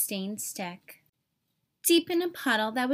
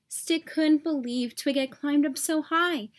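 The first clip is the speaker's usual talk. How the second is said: usual speaking voice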